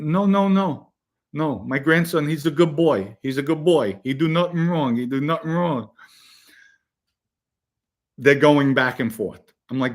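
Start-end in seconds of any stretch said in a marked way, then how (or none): 0.0-5.9 higher-pitched, broken-English Italian grandmother; protesting in an Italian grandmother's voice. Retold or imitated. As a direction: imitate an old Italian nonna pleading for her grandson. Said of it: "speaks broken English".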